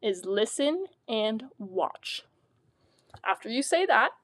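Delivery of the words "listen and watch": slow, deliberate classroom instruction; slow teacher voice. Shown as a demonstration. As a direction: spell it out slowly for young readers. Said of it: "The first thing I will say to the students".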